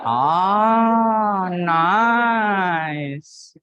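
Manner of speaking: long swooping "aah, nice"; playfully prolonged admiration; drawn-out approval. Real, not acted. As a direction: stretch both words out in exaggerated delight. Teacher reacts to student's answer.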